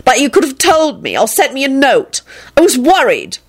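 acted worried hurt. A narrator's reading. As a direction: reproach them in a hurt voice. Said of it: "Elizabeth replied in a hurt voice".

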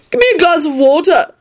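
high, insistent demand; importunate. A narrator's reading. Neville pesters Lupin for water, importunate. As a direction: pester him high-pitched. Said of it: "said importunately to Lupin".

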